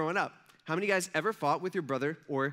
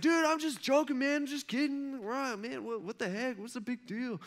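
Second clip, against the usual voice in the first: mock protest; 7 semitones higher than usual. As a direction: back off in a high voice that fades. Excuse from the retold classmate.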